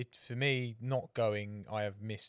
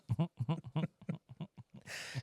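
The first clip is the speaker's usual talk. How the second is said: a burst of genuine laughter; real laugh; 6 semitones higher than usual. Real, not acted. Laughing to his co-host over the story.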